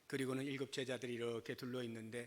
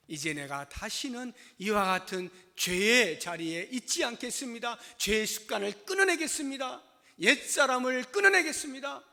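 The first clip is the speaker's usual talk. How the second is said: rising, louder and higher declaration; a believer vowing to quit sin, rising loud; fervent vow; 7 semitones higher, 10 dB louder than usual. Acted. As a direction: build into a passionate resolve. Voicing a resolute vow.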